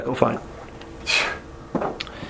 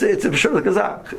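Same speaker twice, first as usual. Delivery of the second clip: usual speaking voice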